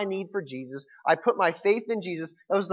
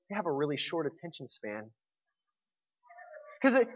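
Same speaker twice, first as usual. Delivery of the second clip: usual speaking voice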